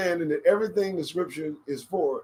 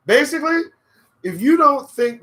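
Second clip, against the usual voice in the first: excited squeal; 11 semitones higher, 10 dB louder than usual. Real, not acted. Sudden high outburst.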